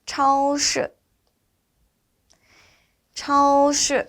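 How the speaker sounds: high level 차오, falling 시; repeat-after-me model. Shown as a demonstration. Teacher leading students to repeat 차오시, high careful model. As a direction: hold 차오시 high, dropping only at the end. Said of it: "발음 한번 같이 따라해서".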